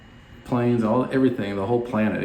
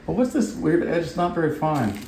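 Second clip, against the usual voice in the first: high, puzzled voice of a picky viewer; much higher voice, playfully fussy; mock puzzled viewer; 8 semitones higher than usual. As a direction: squeak it out like someone fussing over an edge. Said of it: "you look at that and you go".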